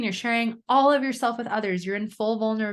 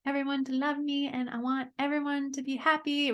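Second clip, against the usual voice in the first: put-on childlike, pleading to be liked; little [name]'s voice; 6 semitones higher than usual. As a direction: voice the scared little girl inside. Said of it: "who's just like".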